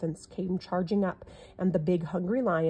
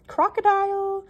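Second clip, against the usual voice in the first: higher, louder call across the water; 5 semitones higher, 4 dB louder than usual. A narrator's reading. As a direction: shout a polite question to the crocodile. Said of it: "The little elephant called out".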